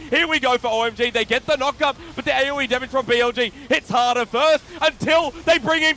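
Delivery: loud, high, thrilled play-by-play call; excited shouting. Real, not acted. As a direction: yell the big teamfight as it unfolds. Teamfight climax in live cast.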